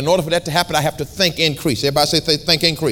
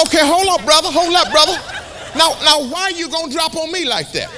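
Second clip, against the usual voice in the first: playful comeback; 13 semitones higher than usual. Retold or imitated. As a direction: push back with a grin. Preacher retorts to stranger.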